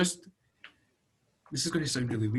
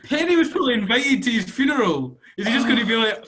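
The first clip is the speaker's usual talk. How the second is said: high, loud, laughing protest; real giggling incredulity; helpless laughter; 8 semitones higher, 5 dB louder than usual. Real, not acted. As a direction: barely get it out for laughing. Still cracking up at the funeral.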